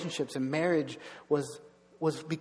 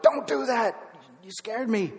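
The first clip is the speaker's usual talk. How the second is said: retold angry blurt, higher but restrained; frustrated blurt; 5 semitones higher than usual. Retelling his outburst at Amy in the car, frustrated blurt. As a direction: blurt it out with a bit of anger. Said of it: "I kind of blurted out".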